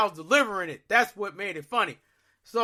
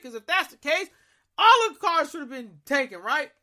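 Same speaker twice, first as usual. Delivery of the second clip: much higher and louder, rushing; angry rant; 8 semitones higher, 4 dB louder than usual. Retold or imitated. He vents at the tow yard's voicemail. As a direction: vent at the useless tow line.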